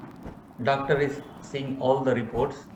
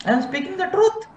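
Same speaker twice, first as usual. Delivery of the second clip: mock self-righteous; 14 semitones higher, faster than usual. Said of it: "Can I call a blind man".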